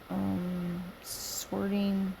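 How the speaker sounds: usual speaking voice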